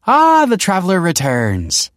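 high, loud, slow grand declaration; declaring. Acted. The other mother greets Michael, theatrical high proclamation. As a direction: hail him like a hostess making an entrance. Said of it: "declared the other mother".

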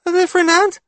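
put-on very high, loud child voice; piping child question; questioning little boy. A narrator's reading. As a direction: ask it like a doubtful small kid. Child guesses at the creature.

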